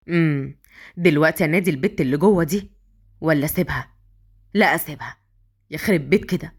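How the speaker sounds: low-pitched male character debating with himself; hesitant hero musing. A narrator's reading.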